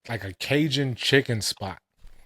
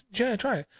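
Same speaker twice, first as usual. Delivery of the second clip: much higher pitched, pushy host voice; mock eager restaurant owner; 12 semitones higher than usual. Restaurant owner urging the guest to taste, high mock voice. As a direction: coax him to try the food in a high put-on voice.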